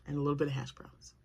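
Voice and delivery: quick whispered aside; fast hushed confession. Real, not acted. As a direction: whisper it like a guilty treat. Tempting food aside.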